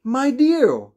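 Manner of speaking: high, loud startled exclamation; shocked cry. A narrator's reading. Old woman seeing the cats arrive, crying out high and loud. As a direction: cry out in dismay at the sight. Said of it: "she cried".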